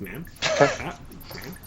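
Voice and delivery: short questioning words with a rising lilt; puzzled creature echo. Acted. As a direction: repeat each word curiously, like a young creature. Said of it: "You heard, like, telepathically in undercommon".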